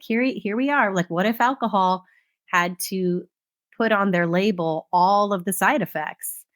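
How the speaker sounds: ordinary delivery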